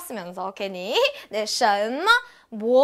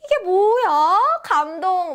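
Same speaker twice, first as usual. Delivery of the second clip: high, louder mock amazement at a gift; feigned delighted surprise; 5 semitones higher, 5 dB louder, slower than usual. Woman given flowers pretends she has no idea, hammed-up surprise. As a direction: gush as if moved by the flowers. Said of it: "막 이러고 있죠".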